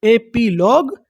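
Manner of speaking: Epilogue in separate parts, rising at the end; measured breakdown of epilogue, pitch lifting; syllable by syllable. Shown as a demonstration. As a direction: sound out epilogue for a learner. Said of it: "उसे क्या बोलते हैं".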